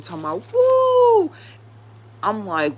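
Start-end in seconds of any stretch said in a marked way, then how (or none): 0.5-1.3 very high, loud whooping yell; imitated street yell. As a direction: let out a piercing street-preacher whoop. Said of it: "standing on this little platform screaming and yelling".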